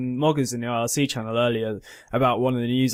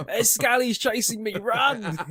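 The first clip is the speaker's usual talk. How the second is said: mock panicked runner; 8 semitones higher, slower than usual. Acting a fleeing person.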